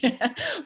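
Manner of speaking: quick high laugh at her own joke; short laugh. Real, not acted. Mom joking about her dogs as kids: short high laugh.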